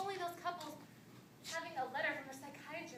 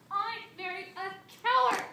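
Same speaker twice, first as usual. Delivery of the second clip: loud, slow, raised outburst; furious accusation; 5 semitones higher, 13 dB louder, slower than usual. Acted. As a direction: hurl the insult at your husband. Wife accuses husband in quarrel.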